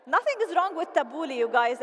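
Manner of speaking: ordinary talk, nothing marked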